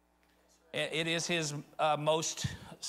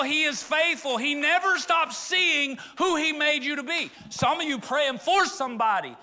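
high, loud, building sermon cry; rising pulpit fervor, high and loud; full-force preaching; 8 semitones higher, 5 dB louder than usual. Acted. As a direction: preach it out over the crowd.

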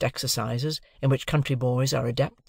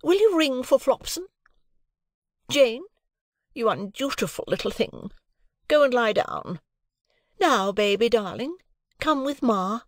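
much higher, affronted lady voice; a proud wife refusing all interference; high indignant lady voice; haughty Mrs Pocket; 10 semitones higher than usual. A narrator's reading. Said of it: "said Missus Pocket".

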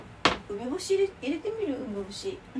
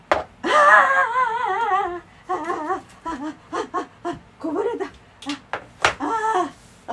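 drawn-out, higher, louder wails as the pot spills over; real alarm at food spilling; dismayed wails; 8 semitones higher, 7 dB louder, slower than usual. Real, not acted. Pot spilling while cooking. (こぼれて慌てる長い叫び)

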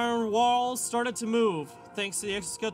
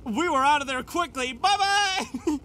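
gleeful; 7 semitones higher, 8 dB louder than usual. Real, not acted. Escaping the monster, gleeful.

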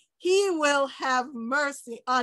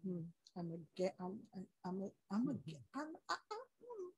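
scheming murmur; 6 semitones lower, 19 dB quieter, faster than usual. Retold or imitated. Imitating scheming plotter in bed.

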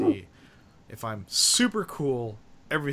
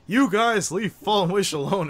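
mock angry plea; 6 semitones higher, 7 dB louder than usual. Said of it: "fought with us tooth and nail".

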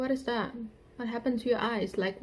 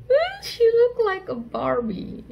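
high excited fan squeal; fangirl squealing; 13 semitones higher, 6 dB louder than usual. Real, not acted. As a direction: squeal over the photo. Fangirl reacting to photo.

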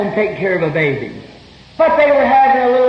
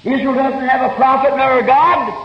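usual speaking voice

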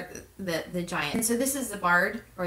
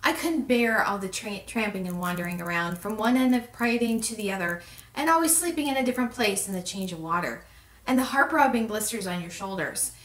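ordinary delivery

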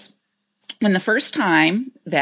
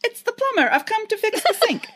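much higher, playful plumber quote; quoting the plumber. Retold or imitated. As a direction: pitch it way up like the skit. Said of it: "I wanna say".

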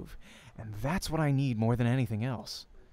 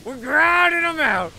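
higher still, loud sustained yell; hyped cheer; 13 semitones higher, 9 dB louder than usual. Acted. Narrator cheering again over practice games, loud yell. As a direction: belt it out, victory chant.

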